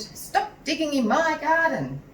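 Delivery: recalling Mrs Cripps screaming at the dog; mock scream. A narrator's reading. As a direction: shout it like the old owner. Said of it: "No one screamed".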